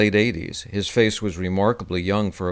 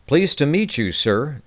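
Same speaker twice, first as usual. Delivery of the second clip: Texas twang; 5 semitones higher than usual. Acted. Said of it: "in his characteristic twang".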